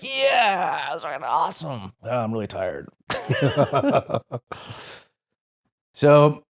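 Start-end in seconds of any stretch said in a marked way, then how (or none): 0.0-1.9 excited cheer. Retold or imitated. Cheering the rocket strike. 2.9-4.9 laugh. Real, not acted. Laughing at being tired.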